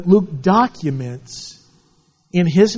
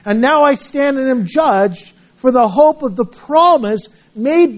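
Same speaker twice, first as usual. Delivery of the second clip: raised, slow solemn reading; scripture reading; 5 semitones higher, 3 dB louder than usual. Acted. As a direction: proclaim the verse to the congregation. Reads scripture aloud.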